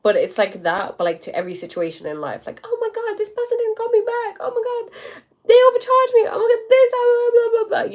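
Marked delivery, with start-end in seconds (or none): 2.6-7.9 very high, frantic worrier impression; squealed, loud mock stress; mock panic. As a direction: wail like everything is a disaster, hammed up. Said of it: "the kind of stress you would have".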